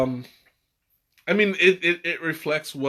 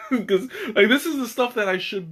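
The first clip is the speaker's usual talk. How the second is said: laughing; 9 semitones higher than usual. Real, not acted. Embarrassed laugh at getting ahead.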